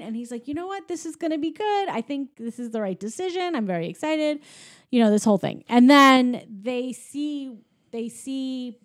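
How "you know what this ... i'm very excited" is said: high but softly voiced eagerness; retold excitement, lightly acted; excited. Woody deciding he's going along, lightly acted excitement. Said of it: "he's really excited to be going".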